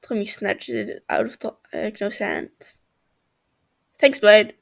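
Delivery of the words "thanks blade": loud, bratty thank-you; cheeky loud thanks. Acted. Tommy snatching the water ice from Techno, loud and cheeky. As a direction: call it out with a cocky grin.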